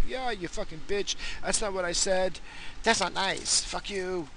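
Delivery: high-pitched mock yelling at a phone; yelling at Siri. Vlogger yelling at Siri, high-pitched mock anger. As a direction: tell off the assistant, mock outrage. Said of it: "Like how I yelled to Siri".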